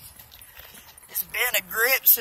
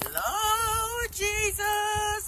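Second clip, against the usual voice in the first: mock plea; 10 semitones higher, 3 dB quieter, much slower than usual.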